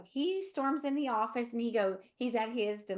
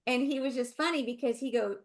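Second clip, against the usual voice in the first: usual speaking voice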